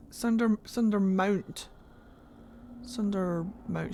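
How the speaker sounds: Sundermount drawn out slowly, testing the word; slow sounding-out. Shown as a demonstration. Streamer puzzling over how Sundermount is said, slow sounding-out.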